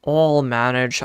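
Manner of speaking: ordinary talk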